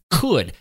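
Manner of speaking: "Could" said alone, punched with a falling finish; a single pointed "Could"; stress on could. Shown as a demonstration. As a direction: set "Could" apart as its own firm word. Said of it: "I want to emphasize the word could".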